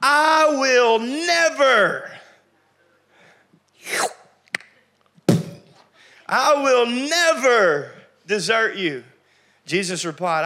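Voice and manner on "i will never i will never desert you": slow, drawn-out vow, loud and high; emphatic vow. Acted. Preacher playing Peter swearing he will never desert. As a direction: stretch out each word of never like a sworn oath. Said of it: "watch this".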